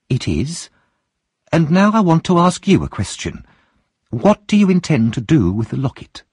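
high, bold woman's voice; Mrs Bumble. Acted. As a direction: question him boldly. Mrs Bumble questions Monks.

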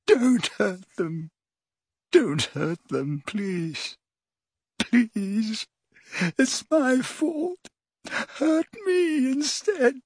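high, slow, anguished pleading; moaning plea. A narrator's reading. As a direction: beg to take the pain instead. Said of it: "as he moaned".

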